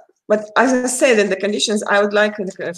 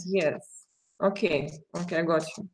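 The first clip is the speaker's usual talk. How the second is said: ordinary talk, nothing marked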